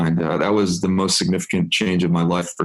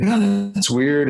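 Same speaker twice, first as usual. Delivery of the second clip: mild puzzlement; 11 semitones higher, much slower than usual. Retold or imitated.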